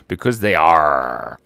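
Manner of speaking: low, slow, loud-ended pirate growl on ARE; pirate "arrr". Acted. Giving the pirate joke's punchline, drawn-out pirate voice. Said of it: "Why are pirates called pirates?".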